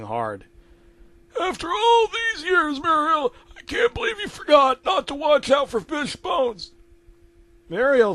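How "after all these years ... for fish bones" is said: very high crabby character voice; Eustace scolding. Acted. Eustace scolding Muriel over fish bones, high and crabby. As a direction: nag your wife in a high-pitched voice.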